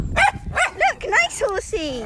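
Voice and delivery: look, sung up high and falling; high pet voice. Real, not acted. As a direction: coo it high to the horse. Cooing at horses.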